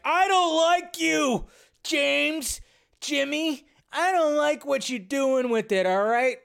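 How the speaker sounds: high, loud, drawn-out put-on complaint; mock confrontation. Fan imagined confronting the director on set, mock confrontation. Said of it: "show up to the set and be like".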